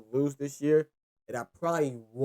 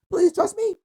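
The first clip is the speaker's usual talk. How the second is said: high, loud plea; pleading; 13 semitones higher, 7 dB louder than usual. Real, not acted. Host begging his viewers to trust his picks, pleading. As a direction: beg them to trust you. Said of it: "pleading with viewers".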